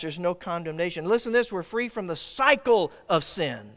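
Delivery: usual speaking voice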